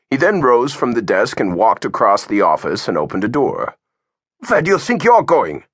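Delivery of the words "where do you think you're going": sharp demanding question; demanding to know where he's going. A narrator's reading. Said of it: "Augie demanded".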